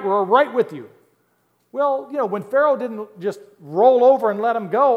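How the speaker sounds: loud, high, fired-up pulpit preaching; preaching at full force. Acted. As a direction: preach it hard to the congregation.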